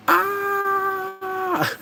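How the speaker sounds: long, high-pitched mock scream; high drawn-out squeal. Reacting as they shake hands on the movie, long high squeal. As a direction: hold one high "ah".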